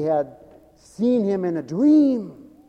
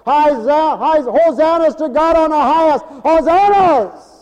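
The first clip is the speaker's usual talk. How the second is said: crowd praise; 10 semitones higher, 8 dB louder than usual. Retold or imitated. Crowd's earlier praise voiced.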